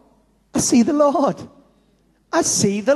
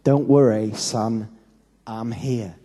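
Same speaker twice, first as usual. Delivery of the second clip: put-on calm a scared child; dad comforting his son in the pool; reassuring father; 9 semitones lower, slower than usual. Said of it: "He said".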